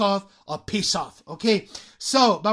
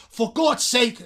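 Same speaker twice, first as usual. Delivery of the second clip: exasperated exclamation; 3 semitones higher, 5 dB louder, slower than usual. Shown as a demonstration. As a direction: exclaim it as if fed up. (exclamación de hartazgo fingido)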